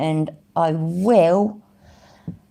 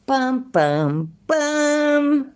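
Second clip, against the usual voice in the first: sung "dun-dun-dun" style sting, last note higher; mock dramatic sting; 9 semitones higher, much slower than usual. Streamer capping the court record reading with a mock dramatic sting. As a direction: intone three drawn-out suspense notes.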